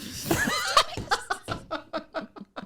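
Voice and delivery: long, high, helpless laugh. Real, not acted. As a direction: laugh at your own boast. Laughing at four laps.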